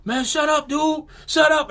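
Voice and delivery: high, tense hushing plea; high frightened shut up; panicked plea. Retold or imitated. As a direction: beg him to stop, scared stiff. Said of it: "my cousin Jay was like".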